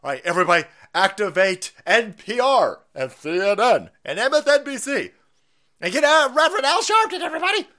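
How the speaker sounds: high, loud, hyped-up announcing; mock rallying call. Host mock-summoning the news channels and everybody, loud rallying call. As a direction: shout it out like a hype man at fever pitch.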